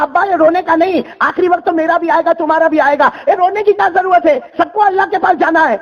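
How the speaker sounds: high, firm daughter's voice. Acted. Daughter consoles father.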